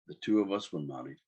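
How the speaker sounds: usual speaking voice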